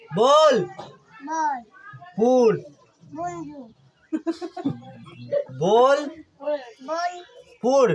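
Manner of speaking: বল and পুর as separate syllables, long pause between; staccato. Shown as a demonstration. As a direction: say বল, stop, then পুর, like teaching a child. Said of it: "থেমে থেমে বলবি".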